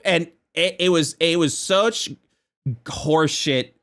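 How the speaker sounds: usual speaking voice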